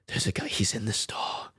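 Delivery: hushed whisper so the men in the stalls can't hear; breathy secret whisper into the phone. Retold or imitated. Whispering to dad on the phone from the stall.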